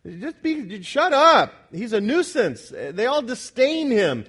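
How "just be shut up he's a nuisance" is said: disdainful hush. Retold or imitated. The populace shutting up the cursed beggar, scornful. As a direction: brush him off as a nuisance. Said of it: "That's the attitude of the populace".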